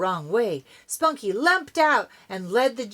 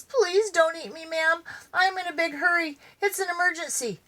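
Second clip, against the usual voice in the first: higher, fast frantic plea; panicked pleading; 9 semitones higher, faster than usual. Acted. Dog begs the eagle in a high frantic plea. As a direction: beg quickly, panicked.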